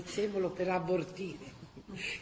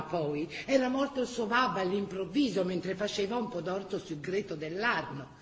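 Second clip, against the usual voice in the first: normal talking voice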